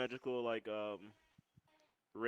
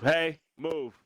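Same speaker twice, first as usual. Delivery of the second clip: real loud, higher-pitched order; calling out; 5 semitones higher, 8 dB louder than usual. Real, not acted. Player telling someone to move, calling out loudly. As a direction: call out sharply to get someone moving.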